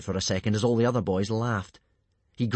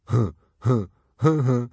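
loud, stupid sidekick guffaw; dim snorting laugh; 3 semitones higher, 7 dB louder than usual. A narrator's reading. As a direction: snort out separate dopey huhs. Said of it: "snorted Dog's Breath the Durbrain".

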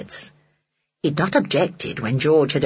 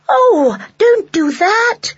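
high, loud, drawn-out wail; children's alarmed cry; 6 dB louder, much slower than usual. A narrator's reading. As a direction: cry out in dismay like pleading children. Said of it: "all the children cried".

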